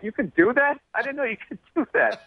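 high, astonished mock gasp of disbelief; stunned disbelief. Comic recalling his own shock, high amazed disbelief. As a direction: act floored that this was even allowed.

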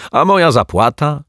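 high, loud, indignant call; stunned shout. A narrator's reading. Detective shouting after the client about his payment, stunned. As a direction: demand the fee of someone already gone. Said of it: "krzyknął oszołomiony".

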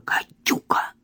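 scary whispered warning; spooky whisper. Acted. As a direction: whisper it to frighten them. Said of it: "Марфа сделала страшные глаза".

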